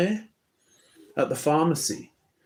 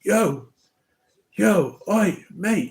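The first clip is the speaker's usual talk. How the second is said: higher, louder, loudly calling "yo, oi, mate"; calling out "Oi" from a distance; 8 semitones higher, 6 dB louder, much slower than usual. Retold or imitated. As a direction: call out to a stranger across the street. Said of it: "all I hear is".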